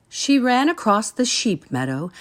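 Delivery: ordinary delivery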